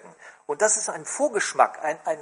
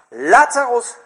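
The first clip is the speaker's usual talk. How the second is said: Lazarus called loud, then komm heraus dropped back to a plain voice; loud call on the name only; 5 semitones higher, 10 dB louder, slower than usual. Acted. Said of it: "Und er sagt".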